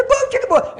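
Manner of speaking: very high, loud echo; kids echoing. Acted. Kids repeating after the pastor, very high shout. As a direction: yell the chant back excitedly.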